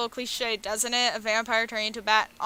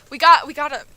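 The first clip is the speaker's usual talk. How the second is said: a loud burst of eagerness; excited outburst; 10 dB louder than usual. Real, not acted. Player eagerly picking a date, loud. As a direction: blurt it out eagerly.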